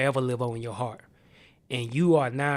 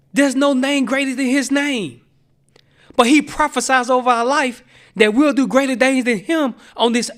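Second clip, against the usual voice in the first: much higher and louder, driving every line home; full-force preaching; 10 semitones higher, 10 dB louder than usual. Acted. Preacher to the congregation, preaching at full force.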